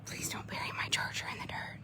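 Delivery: unvoiced hushed warning; whisper. Real, not acted. Mom whispering a warning to her daughter, hushed.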